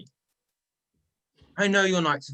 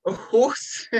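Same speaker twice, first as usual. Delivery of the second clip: rising, mock-shocked question; mock disbelief; 5 semitones higher than usual. Loki mocking Thor's horse jab, mock disbelief. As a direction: act scandalized by the word.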